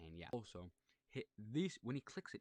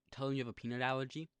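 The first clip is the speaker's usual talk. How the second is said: fast, loud, higher advice to the guys; excited tip; 5 semitones higher, 8 dB louder, faster than usual. Shown as a demonstration. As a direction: rush the secret out eagerly. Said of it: "So guys, if you want".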